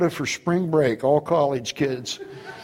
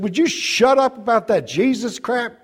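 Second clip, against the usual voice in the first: put-on higher, sharper voice of an irritated girl; annoyed girl; 7 semitones higher than usual. Girl on the bus tells him to stop, irritated shout. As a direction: snap at him to stop preaching.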